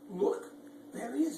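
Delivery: plain reading voice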